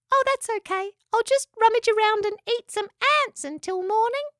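very high, squeaky easygoing voice; little echidna. A narrator's reading. Spike is happy to wait till morning, little echidna voice. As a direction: chirp it like a tiny creature.

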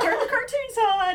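high, loud mock-frantic order; acted-out frantic mom voice; urgent mom instruction. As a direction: hurry the kids into a distraction, laughing. Mother herding kids from angry dad.

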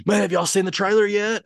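high, loud eager dad question; excited question. Retold or imitated. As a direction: burst out asking, all keyed up. Retelling his excited question to kids.

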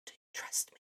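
soft unvoiced whisper. God sitting next to the speaker, soft whisper. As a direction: whisper it gently, right beside them. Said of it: "he whispers and he says".

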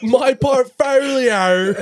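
high, very slow caricature Aussie; Australian accent. Retold or imitated. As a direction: draw out every vowel in mock Australian. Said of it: "TikToks of the Australian accent guy".

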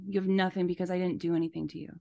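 usual speaking voice

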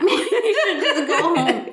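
laughing, drawn out. Real, not acted. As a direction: giggle the words out. Laughing echo of friend's line.

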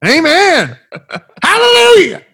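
high, loud hallelujah cry; hammed-up church shout; mock preacher.

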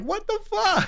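very high-pitched comic disbelief; mock shock. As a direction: squeak it as if blindsided. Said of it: "You're like".

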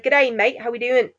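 put-on Australian greeting; Australian accent. Shown as a demonstration. Showing how to switch into an Australian accent. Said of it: "you just gotta, like, switch into it".

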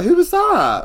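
mock surprise. Mock shocked reaction.

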